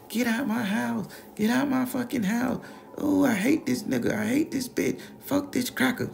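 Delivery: very high shrieking grandma; screaming grandma. Retold or imitated. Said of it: "Grandma just started screaming".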